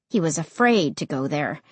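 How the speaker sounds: plain reading voice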